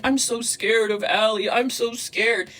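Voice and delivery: slow, whiny fake fright; mock fearful imitation. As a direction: play her pretending to be scared, drawn out. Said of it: "She's like".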